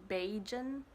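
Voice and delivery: "Bajan" with a long a, bay-jun; local name. Shown as a demonstration. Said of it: "they call themselves".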